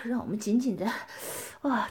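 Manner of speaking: ordinary talk, nothing marked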